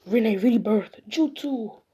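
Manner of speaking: loud, rising on Jutsu; called-out jutsu. Acted. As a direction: cry out the technique. Calling out a jutsu.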